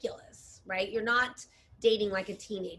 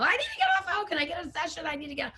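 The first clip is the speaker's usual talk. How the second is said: mock panic; 7 semitones higher, much faster than usual. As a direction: imitate a client begging for a session in a panic. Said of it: "this panicked email".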